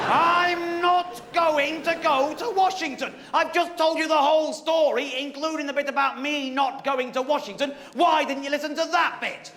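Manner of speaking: high, slow, indignant; mock huffy complaint; hammed-up exasperation; exasperated protest.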